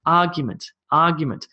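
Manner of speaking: Argument as AR-gument, trailing off after AR; big emphasis on AR. Shown as a demonstration. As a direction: hit AR, let the rest of argument fall away. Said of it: "big emphasis here".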